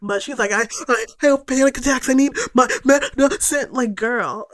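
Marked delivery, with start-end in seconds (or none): 0.5-3.7 higher, gasping between words; badly faked breathless panic; mock panic attack. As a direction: imitate someone hamming up hyperventilation. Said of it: "like, very fake".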